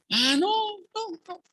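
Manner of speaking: squeaky, high mock brush-off; mock high dismissive voice. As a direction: play the friend shrugging it off in a high, silly voice. Juanito voiced dismissing gospel. (voz aguda y burlona de quien esquiva el tema)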